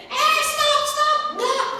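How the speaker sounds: high, loud mock "stop!" from a mother; a hammed-up mother yelling "stop"; mock scolding. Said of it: "Mama saya nggak bilang gini". (ibu yang pura-pura membentak anak-anaknya, nada tinggi dan keras)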